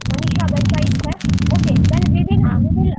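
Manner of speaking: calling out. Real, not acted. Calling for absent presenter.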